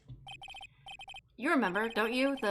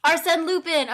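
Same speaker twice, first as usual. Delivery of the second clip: Arsène Lupin! called out loud and sure; triumphant remembering; 5 semitones higher, 9 dB louder than usual. Real, not acted. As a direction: blurt the name out loud, got it at last. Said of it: "so I was technically right".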